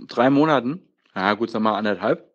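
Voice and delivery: usual speaking voice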